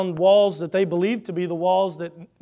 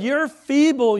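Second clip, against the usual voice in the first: mocking; 8 semitones higher, 7 dB louder, slower than usual. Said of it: "here comes the ridicule".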